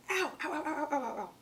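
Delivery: quiet rapid ows of pain. Real, not acted. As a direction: hiss out burnt-finger ows. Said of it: "Why am I putting my fingers right on hot glue?".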